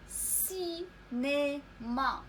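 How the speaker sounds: "سينما" very slow, split into si, né, ma; syllable-by-syllable model. Shown as a demonstration. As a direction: hold each syllable of "سينما", opening on a clear s. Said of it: "تنطق س حيت متبوعة". (نطق "سينما" مقطّعًا ببطء شديد)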